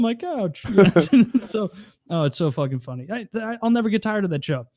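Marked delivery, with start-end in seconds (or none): none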